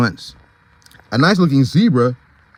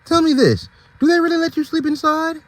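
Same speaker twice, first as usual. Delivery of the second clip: much higher curious question; high zebra voice. Acted. Zebra asks the donkey about the barn, high curious voice. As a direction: lean in, eager to know. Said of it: "Ned asks Max".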